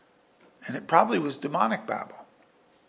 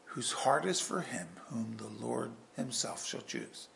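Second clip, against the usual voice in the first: hushed reminder; 2 semitones lower, 12 dB quieter than usual. Acted. Preacher reminds Saul what Samuel said, hushed aside. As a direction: drop right down, nearly whispering by the end.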